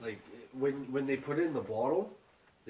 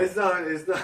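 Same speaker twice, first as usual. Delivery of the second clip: loud protest; 6 semitones higher, 7 dB louder than usual. Acted. Protesting list order.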